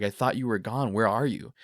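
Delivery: anxious, urgent recalled questions; retold freaking out. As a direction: plead for answers, reliving the shock. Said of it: "you're freaking out".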